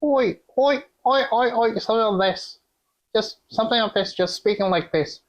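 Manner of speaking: high-pitched put-on Australian villain accent; Chris Hemsworth's movie accent. Co-host samples the villain's accent for friend, high put-on Australian. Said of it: "do you want me to have a sample of it, how it sounds".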